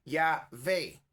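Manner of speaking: Yahvé split "ya-VEY", with a v; pronunciation variant. Shown as a demonstration. Preacher showing what others say, Yahvé with a v. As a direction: say Yahvé in two clear syllables, v not w. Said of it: "Algunos dicen Yahvé".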